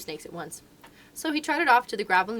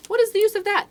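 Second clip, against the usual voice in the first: much higher, louder mongoose voice; a scornful little animal shrugging it off; 13 semitones higher, 9 dB louder than usual. A narrator's reading.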